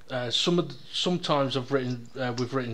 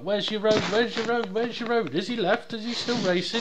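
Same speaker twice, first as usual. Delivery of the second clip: high mock voice; 9 semitones higher than usual. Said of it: "He's like".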